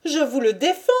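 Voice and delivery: high-pitched, defiant cry; forbidding cry. A narrator's reading. Lucienne forbids the officer to denounce her brother, defiant cry. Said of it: "cria Lucienne avec un geste de recul".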